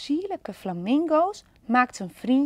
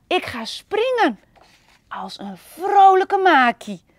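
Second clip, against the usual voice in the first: louder, bouncy and keen; eager plan; 8 dB louder than usual. Acted. Panda deciding to jump like a lemur, loud and keen. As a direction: announce the plan with gusto. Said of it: "riep Panda".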